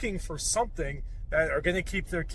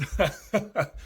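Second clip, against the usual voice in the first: breathy laugh; 6 semitones higher, 4 dB quieter than usual. Real, not acted.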